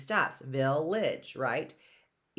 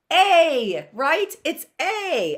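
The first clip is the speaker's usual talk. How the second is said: eager answer; 10 semitones higher, 8 dB louder, slower than usual. Acted. Teacher answers own prompt loudly.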